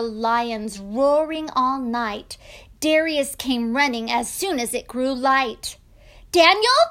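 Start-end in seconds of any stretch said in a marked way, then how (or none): 6.3-6.8 loud, high, rising call; king's urgent cry; anxious shout. Acted. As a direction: call out to someone who may be lost. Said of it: "he shouted".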